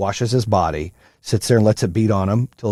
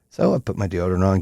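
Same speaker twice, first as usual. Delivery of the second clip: normal talking voice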